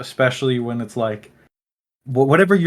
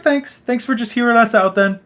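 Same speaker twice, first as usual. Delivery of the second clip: high-pitched mock thanks; imitating polite Mormons; 11 semitones higher than usual. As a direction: lift your voice high, playing a courteous visitor. Voices polite Mormons saying goodbye.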